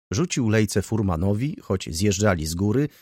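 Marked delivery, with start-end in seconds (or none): none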